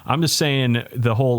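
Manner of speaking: ordinary talk, nothing marked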